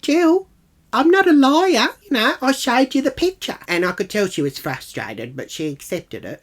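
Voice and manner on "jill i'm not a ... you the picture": high, loud exasperated retort; acted-out outraged defence; indignant protest. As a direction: protest your honesty, pitched right up. Acted protest at being doubted.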